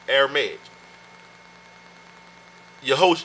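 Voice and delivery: ordinary talk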